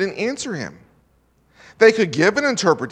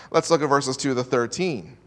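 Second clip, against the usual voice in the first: ordinary talk, nothing marked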